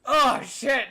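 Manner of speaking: high, loud exclamations of dismay; exasperated yells. Real, not acted. Streamer reacting to a young viewer's age, loud exasperated yells. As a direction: cry out like it physically hurts. Exaggerated groaning at feeling old.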